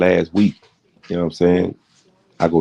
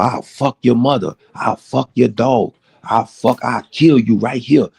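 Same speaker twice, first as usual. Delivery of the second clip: raging threat; 6 semitones higher than usual. Retold or imitated. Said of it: "He told me".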